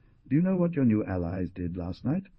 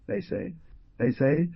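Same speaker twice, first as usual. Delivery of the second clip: halting, higher repeated start; hesitant; 9 semitones higher than usual. A narrator's reading. Hunter answering the young man's question, hesitant and broken off. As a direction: stall hesitantly, repeating yourself with a gap. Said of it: "replied with some hesitation".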